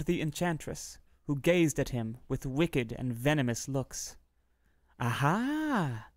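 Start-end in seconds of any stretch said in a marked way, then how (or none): 5.0-6.1 a sly, gloating witch's aha; mocking aha. A narrator's reading. Enchantress to the king's son at the window: gloating mocking aha. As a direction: savor catching him out. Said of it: "she cried mockingly".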